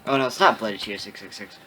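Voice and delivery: usual speaking voice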